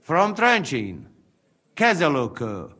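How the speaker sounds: put-on light Hungarian accent, broken English; a hint of a Hungarian accent. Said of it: "that accent".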